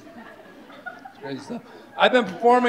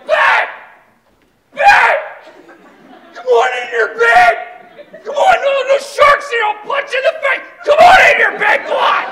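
high, loud macho yelling; yelling at his lady; 7 dB louder than usual. Retold or imitated. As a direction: holler at your girlfriend from the water. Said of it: "he's yelling at her".

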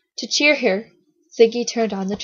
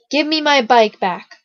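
loud, higher demand; shouted; 4 semitones higher, 7 dB louder than usual. A narrator's reading. Boy wanting his bike from Siggy, shouted demand. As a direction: demand it back with raised voice. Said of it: "the strange boy shouted".

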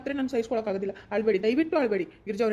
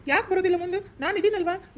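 much higher, louder eager protest; excited insisting; 8 semitones higher, 4 dB louder than usual. Acted. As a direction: burst in, insisting you believe her. Eager man volunteers to help.

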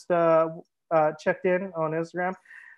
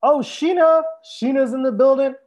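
higher, louder delighted shout-out; excited greeting; 7 semitones higher, 5 dB louder than usual. Real, not acted. Host spots Sheena joining the livestream, excited greeting. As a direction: hail a friend who just arrived.

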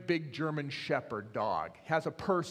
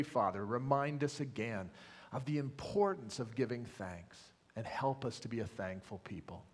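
steady pastoral prayer voice; opening prayer; 4 semitones lower than usual. Acted. Pastor praying over his church, earnest and steady. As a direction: lead the congregation in prayer, earnest.

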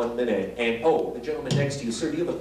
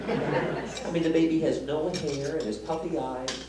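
usual speaking voice

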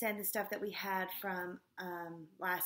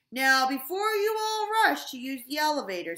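booming, much louder announcement voice; principal on the loudspeaker; 7 semitones higher, 13 dB louder than usual. A narrator's reading. Mister Kitzwatter greets the school over the loudspeaker, booming loud. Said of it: "said Mister Kitzwater".

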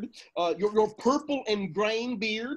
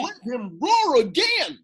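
louder, higher full-throated roar; roaring shout; 6 semitones higher, 6 dB louder than usual. Acted. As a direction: roar it like a lion. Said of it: "I will roar".

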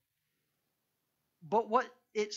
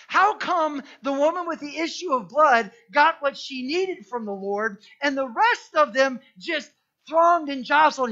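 rising, louder sermon delivery; preaching; 5 semitones higher, 8 dB louder than usual. Acted. Preacher presses the crowd-versus-faith point, louder preaching. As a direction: drive the point home to the congregation.

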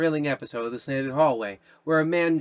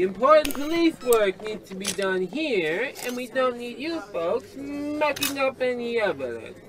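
higher, brassy cop voice; brash police detective; 9 semitones higher than usual. Acted. Detective Matthews clearing the crime scene, brash. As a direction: swagger in and take charge.

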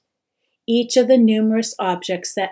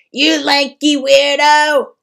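loud, higher mocking yell; jeering cry; 6 semitones higher, 9 dB louder than usual. A narrator's reading. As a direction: taunt him viciously. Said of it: "they cried".

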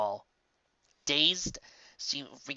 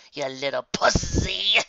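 sneering insult; 4 semitones higher, 7 dB louder, slower than usual. A narrator's reading. Villain insulting.

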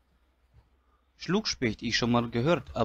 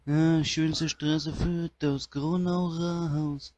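slow Udo drawl; 3 semitones higher, much slower than usual. Acted. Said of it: "schönen Udo".

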